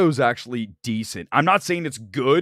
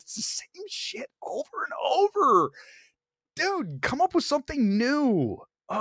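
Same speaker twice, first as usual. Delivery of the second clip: exasperated whine; 14 semitones higher than usual. Real, not acted. Exasperated at critics' clichés.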